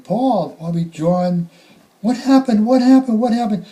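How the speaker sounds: high, loud desperate calling; retold yelling. Mother yelling her sons' names in panic. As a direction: call out their names in panic. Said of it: "yell their name".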